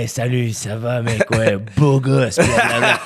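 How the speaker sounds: lightly imitated charismatic French dude; slow, drawn-out mock compliment on beau gosse; Ramsey. Charismatic host greeting the next comic, lightly imitated. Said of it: "avec sa voix un peu".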